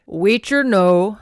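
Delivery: loud, slow Scottish pronouncement; old Scotsman. A narrator's reading. Old Bruce addressing his silent men, loud Scots. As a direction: declare it as an old clan elder. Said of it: "the old man said".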